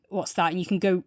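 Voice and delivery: normal talking voice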